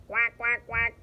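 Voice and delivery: short imitated quacks; three quick quacking sounds; duck quacks. A narrator's reading. Narrator voicing a duck suitor, quick quacks.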